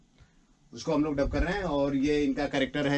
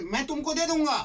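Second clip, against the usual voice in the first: much higher filmy line, दे punched; with feeling; 11 semitones higher than usual. Shown as a demonstration. Director correcting actor's flat take, acted. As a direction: show how to say it with feeling. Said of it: "अच्छे से बोलो".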